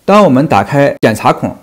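ordinary delivery

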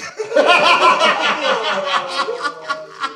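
a long, rolling, helpless laugh. Real, not acted. The host, beaten by his friends' trick, laughing helplessly. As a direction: laugh until out of breath. Guest hears the Beyati punchline.

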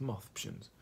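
ordinary talk

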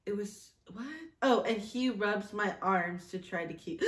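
normal talking voice

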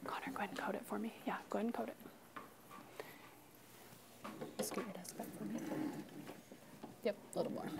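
soft whispered prompt. Real, not acted. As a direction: whisper so only he hears. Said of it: "whispering to one student".

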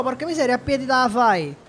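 ordinary talk

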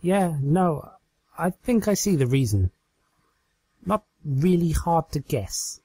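deadpan her disbelief; Twilight, dry. Acted.

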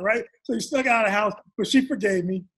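ordinary talk, nothing marked

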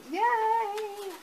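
high, loud yay; little victory squeal; cheer. Real, not acted. Naming the doll's artist, cheering.

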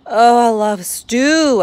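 slow, loud dreamy swoon over food; drawn-out, loud love-struck sigh of the words. Acted. Hungry cat swoons at the smell of stew, slow and loud. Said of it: "I swooned".

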